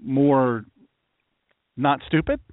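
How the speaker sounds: usual speaking voice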